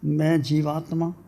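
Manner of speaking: measured meditation voice with long pauses, softening as it goes; guided meditation. Real, not acted. Teacher leading seated devotees into inner silence, guided meditation. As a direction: guide listeners inward, phrase by phrase, letting endings fade.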